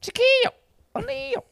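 very high falsetto squeak; squeaky voice. Pretend. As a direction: squeak the name out. Squeaky name callout.

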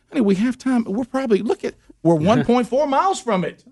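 high, coaxing honey voice; coaxing wife. Retold or imitated. Husband to wife, high coaxing plea.

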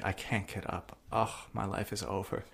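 soft, weak mock despair; depressed and bedridden. Imitating the bedridden heroine, hammed-up soft misery. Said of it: "so depressed and bedridden".

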